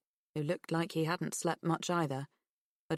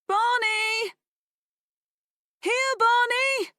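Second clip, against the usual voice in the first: high, loud, slow call; drawn-out call for the dog; 8 dB louder, slower than usual. A narrator's reading. Girl calling her missing dog, high and slow. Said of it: "Pip wet her lips and whistled".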